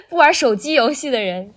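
usual speaking voice

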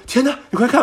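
high, loud burst of astonishment; amazed shout. Retold or imitated. Shouting to friends about the jump-rope man, amazed. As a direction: call out to your friends in shock. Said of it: "我当时就惊了".

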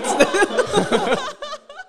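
high, loud burst of laughter; loud shrieking laugh. Real, not acted. Laughing at the joke about the old school, a loud shriek.

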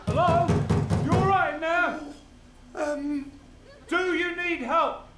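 ordinary delivery

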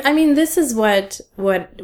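ordinary talk

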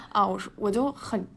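normal talking voice

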